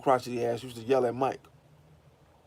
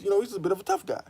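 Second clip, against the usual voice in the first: ordinary talk, nothing marked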